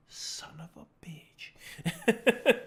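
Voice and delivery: slow, drawn-out mock whisper; stretched whispery mock cursing; retold exasperated curse. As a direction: whisper it slowly, as if catching the culprit. Retold reaction to sneaky cat.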